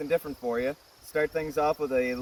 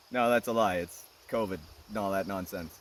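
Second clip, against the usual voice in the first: ordinary talk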